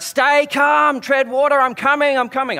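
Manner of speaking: high, loud calls across the water; shouting out. Retold or imitated. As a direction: shout to reach a panicking swimmer. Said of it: "I was trying to shout out".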